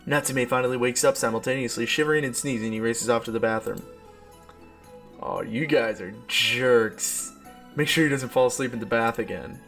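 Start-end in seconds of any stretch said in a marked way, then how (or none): none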